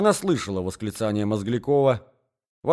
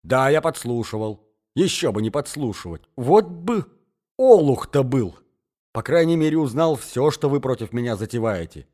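loud, defiant comeback; rude retort; 3 semitones higher, 5 dB louder than usual. Acted. Accused eavesdropper hits back at the hostess, rudely. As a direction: answer roughly, working up your own anger. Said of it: "грубо отвечал Мозгляков".

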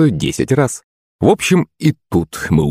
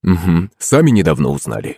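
usual speaking voice

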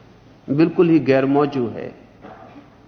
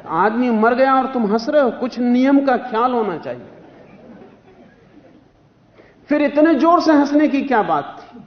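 higher-pitched mock telling-off; retold scolding; 8 semitones higher than usual. Elders scold schoolboys for laughing at a funeral, imitated scolding. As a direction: imitate an elder reprimanding children. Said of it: "बहुत डांट डपट पड़ी".

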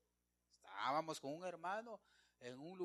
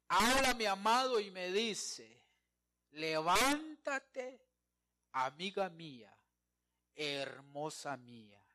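dramatic scripture reading; 3 semitones higher, 9 dB louder, slower than usual. Acted.

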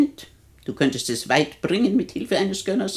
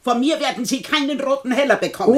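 raised, emphatic pitch in refusal; indignant and higher; defiant refusal; 7 semitones higher than usual. Acted. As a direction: refuse firmly, voice climbing.

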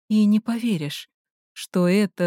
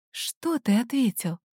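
softly asked, cautious question; quiet question; 3 semitones higher, 5 dB quieter than usual. A narrator's reading. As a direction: ask it under your breath. Heroine questions Ryan, then repeats. (тихий осторожный вопрос)

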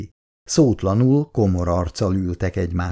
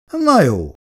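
mocking; 7 semitones higher, 4 dB louder than usual. A narrator's reading. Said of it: "mondta Polonyi gúnyosan".

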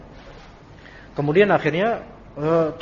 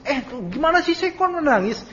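exasperated complaint; 9 semitones higher than usual. Retold or imitated. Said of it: "bukan orang yang malah nangis".

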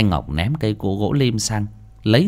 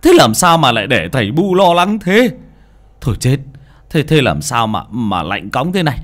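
put-on loud, fussing old father; worried scolding father; 5 dB louder than usual. Father scolding his frozen son, loud and fretful. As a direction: scold the boy out of pure worry.

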